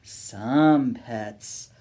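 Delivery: plain reading voice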